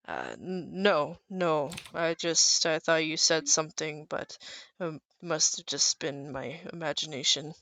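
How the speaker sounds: hiding fear. Acted. Intimidated patron backing off before his friends, hiding fear. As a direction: back down quietly, trying to save face. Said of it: "pretty scared, but he doesn't wanna show it".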